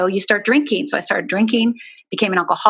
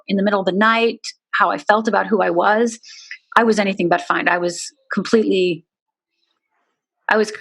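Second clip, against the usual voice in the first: usual speaking voice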